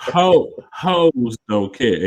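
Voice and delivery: HOES stretched long and slow; slow, stretched "hoes don't care"; drawn-out delivery. Shown as a demonstration. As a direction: draw out "hoes" slowly, with relish. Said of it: "you gotta say it like that".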